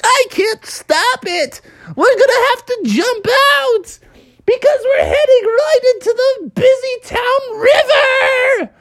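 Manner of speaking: very high, drawn-out cartoon scream; hammed-up hysterical shouting; panicked driver. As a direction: wail it as doom approaches, stretching the words. Frumble panics before the crash.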